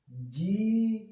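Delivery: long, sustained syllables; sweetly drawn-out reading demo; melodious cuckoo voice. Shown as a demonstration. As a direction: stretch जी, व, नी one by one. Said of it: "सुरीली आवाज में".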